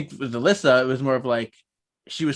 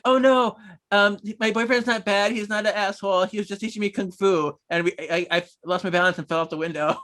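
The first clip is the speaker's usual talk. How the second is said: high, flustered cover story; hasty excuse; 6 semitones higher than usual. Retold or imitated. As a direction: nervously cover for the boyfriend. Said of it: "she's like".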